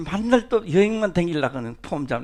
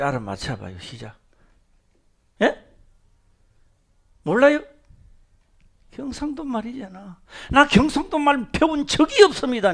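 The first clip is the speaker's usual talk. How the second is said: ordinary talk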